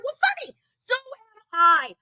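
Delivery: sarcastic retort. Retold or imitated. Retold fight with a woman.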